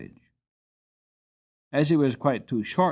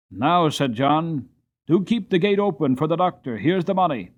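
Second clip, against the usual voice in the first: loud, higher, hurried instructions; urgent call; 5 semitones higher, 5 dB louder than usual. A narrator's reading. John calls to the toll-gate man, loud and urgent. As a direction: shout it over the gate.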